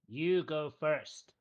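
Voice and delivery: slow, deliberate goblin chief voice; slow goblin boss. Acted. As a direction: drag each word out, ominous. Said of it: "says to him".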